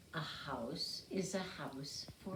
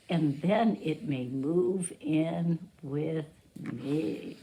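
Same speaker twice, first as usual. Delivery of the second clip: put-on reading to children; 8 dB louder than usual. Teacher reads picture book aloud.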